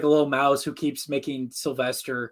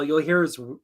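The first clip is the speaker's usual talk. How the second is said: normal talking voice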